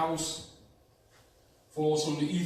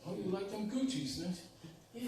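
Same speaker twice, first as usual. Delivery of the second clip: higher, soft teasing witch; good witch voice; 5 semitones higher, 7 dB quieter than usual. Retold or imitated. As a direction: coo it like a sly good witch. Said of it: "And the good witch said".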